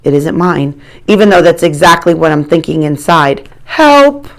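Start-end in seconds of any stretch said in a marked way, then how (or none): 3.6-4.3 high distant cry for help. Acted. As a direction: call out high and loud. Voice calling from bathroom.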